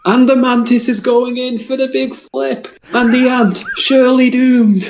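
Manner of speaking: high, hyped-up commentary; dramatic commentator voice. Pretend. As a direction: call it like a wildlife fight. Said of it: "Jonathan Pierce to do Blue Planet".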